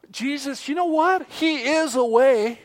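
gasp, then gushing high and loud; mock gushing. False teacher gushing to listeners, high and loud. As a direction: sell the lie with wide-eyed enthusiasm.

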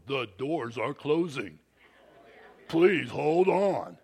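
lightly imitated cold train announcer, slow on hold on; stern announcer. Airport train announcement warning riders, mildly imitated stern voice. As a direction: sound like an unfriendly automated voice. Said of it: "this guy's got an insane voice".

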